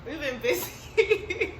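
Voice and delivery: laughing through the words; giggling while saying it. Real, not acted. Laughing about hectic schedule.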